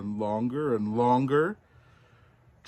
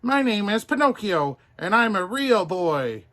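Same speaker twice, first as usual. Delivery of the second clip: put-on high, loud boyish declaration; cheeky wooden boy; proud puppet boy; 9 semitones higher, 7 dB louder than usual. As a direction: announce it proudly like a little boy.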